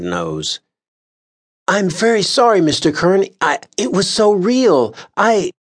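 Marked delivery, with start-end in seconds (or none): 1.7-5.5 high, halting apology; flustered juror stumbling over words; stammering Mister Lyons. A narrator's reading. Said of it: "stammered Mister Lyons".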